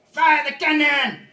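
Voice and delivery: very loud, high, drawn-out yell; mock battle command. French guy ordering the attack, mock battle yell. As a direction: bellow it like an over-the-top gunnery order.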